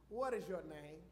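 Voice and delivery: lightly voiced God questioning Jacob; God asking. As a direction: ask "What is your name?" low, stressing "What". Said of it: "he said to him".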